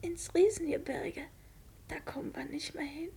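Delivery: tonlos geflüstert, ergeben; flüstere es, als wüsstest du, dass es zu Ende geht; traurig geflüstert. Acted. Todkrankes Kind ahnt ihr Ende. (sterbendes Mädchen flüstert dem Onkel ihre Ahnung zu)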